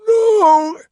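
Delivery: very high, loud wail; horrified cry. A narrator's reading. Carstairs falls to his knees, horrified cry. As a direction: scream in dread as all is lost.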